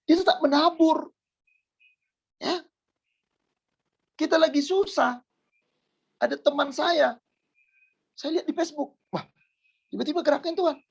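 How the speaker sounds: high, ringing exhortation; impassioned preaching. Real, not acted. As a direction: preach it high, urging the congregation on. Preaches a testimony with raised voice. (khotbah berapi-api bernada tinggi)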